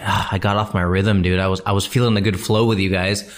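ordinary delivery

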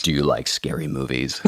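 low, soft menacing phone voice; creepy horror villain voice. Pretend. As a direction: play the masked slasher on the phone. Joke after "deeper" voice remark.

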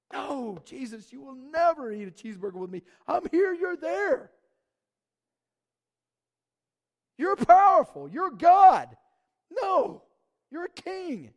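high, loud, slow pleading cry; Peter's protest. Acted. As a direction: insist he is far above you. Preacher voices Peter's protest.

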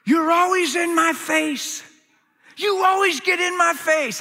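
high-pitched, drawn-out accusation; retold screaming. Young man screaming at a book in his bedroom, high retold rant. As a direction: act out screaming at someone you're mad at. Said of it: "I was just screaming at it".

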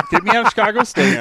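high, loud mock cry to be freed; hammed-up plea to escape. Guest jokingly begs to leave Chicago State, loud mock plea.

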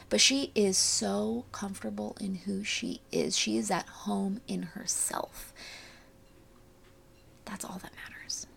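fading breathy hush; airy breathy mystical healer. Acted. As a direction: let it dissolve into a whisper. Soft conclusion on sister.

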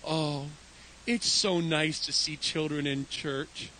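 sweet gentle lady. Retold or imitated. New lady answers apologetic pastor, sweet gentle calm. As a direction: imitate a kindly lady, unhurried and forgiving. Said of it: "Priscilla looks at me with those big".

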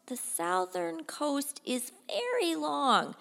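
high, slow, hesitant explanation; anxious subordinate stretching out the bad news; worried official. Worried secretary explains the problem.

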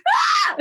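shrill laughing scream, high and loud; panicked scream. Retold or imitated. Singer relives flight case panic, laughing shriek.

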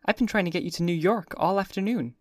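put-on high society girl, playful; Daisy teasing. A narrator's reading. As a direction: tease her, light and bright.

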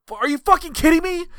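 high-pitched outraged mock rant; exasperated, squealing disbelief; losing it. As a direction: imitate a wrestler at the end of his rope. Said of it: "watching him lose his shit".

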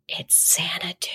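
hushed, breathy whisper of the answer; a sly whispered punchline; whispered reveal. Retold or imitated. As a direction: let it slip out as a secret. Older man reveals answer, whispered.

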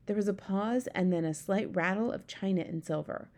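plain reading voice

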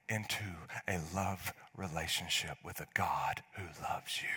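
soft, breathy whisper; whispered invitation. Real, not acted. Pastor inviting listeners into God's love, a whisper. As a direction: whisper it tenderly.

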